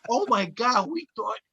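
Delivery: mock surprise. Band to comedian they took for security, surprised. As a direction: act out the sudden realisation. Retold band realising their mistake.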